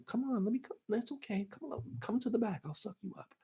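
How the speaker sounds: high, soft imitation of a woman; mock female voice, cajoling; female cop. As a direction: coax in a put-on falsetto. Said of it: "the fucking female cop had to".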